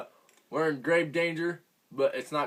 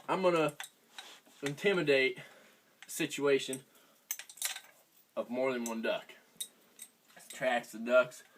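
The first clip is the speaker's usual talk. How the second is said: ordinary talk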